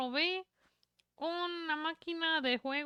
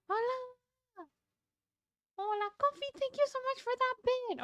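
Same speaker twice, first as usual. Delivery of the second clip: high cutesy voice; 10 semitones higher than usual. Pretend.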